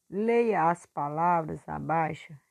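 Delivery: ordinary talk, nothing marked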